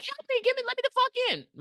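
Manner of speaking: very high, acted panic; frantic plea. Fleeing girl begging a truck driver, high and frantic. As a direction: beg in a high squeal to be let in.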